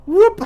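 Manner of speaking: high, loud sudden "whoop"; startled whoop. Retold or imitated. Player imitating someone suddenly warping in on him, startled whoop.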